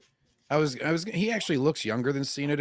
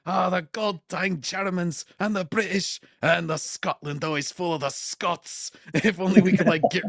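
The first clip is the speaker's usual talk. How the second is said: Groundskeeper Willie; 4 semitones higher than usual. Acted. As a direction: grumble through a put-on Scottish accent. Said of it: "where Willie's just like".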